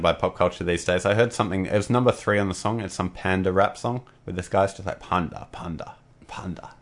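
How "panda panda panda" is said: mock British accent, soft and fading; imitating British accent. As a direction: parody a British rapper chanting panda. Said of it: "saying it like that in this British accent".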